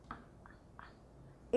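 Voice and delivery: whispered A, a, a; a sound. Shown as a demonstration. Teacher sounding out estuda for pupils, whispered a. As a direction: breathe out a soft a with no voice.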